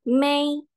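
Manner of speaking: MAY held high and level; first tone. Shown as a demonstration. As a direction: sustain May on one high note. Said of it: "di bagian may-nya aja". (May dengan nada satu, tinggi dan rata)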